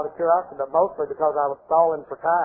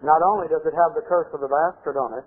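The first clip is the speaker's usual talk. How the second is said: ordinary talk, nothing marked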